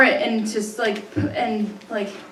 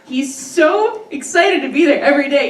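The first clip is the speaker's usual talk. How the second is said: ordinary delivery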